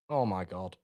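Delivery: ordinary talk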